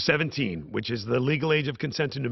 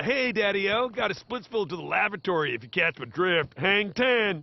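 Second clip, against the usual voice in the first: higher, hammed-up hepcat slang; cool guy voice; 11 semitones higher than usual. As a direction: play a corny fifties cool cat. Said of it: "What's something a cool person would say?".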